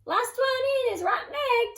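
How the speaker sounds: high, loud playful call. A narrator's reading. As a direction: shout the dare to the others. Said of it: "Toot called".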